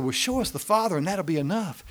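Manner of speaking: high, loud eager plea; disciple asking. Retold or imitated. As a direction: push it up like a pleading disciple. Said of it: "and the disciple said".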